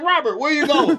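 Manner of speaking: high, puzzled coworker calling out; a bandleader wondering where he's off to; puzzled bandmate. Pretend. Bandmate asks the leaving grandfather where he's going, high and puzzled.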